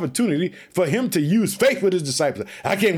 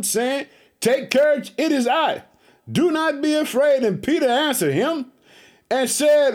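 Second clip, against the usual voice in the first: raised, measured preacher's scripture read; 5 semitones higher than usual. Acted. Preacher reading the Gospel aloud to his church, raised and measured. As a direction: lift the Bible verse up to the congregation.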